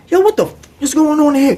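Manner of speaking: high, alarmed demand; mock officer. Officer pulling the door open, high mock voice. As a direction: bark at the cell.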